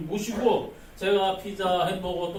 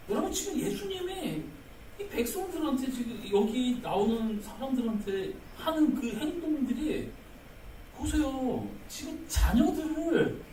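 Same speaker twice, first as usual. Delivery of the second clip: normal talking voice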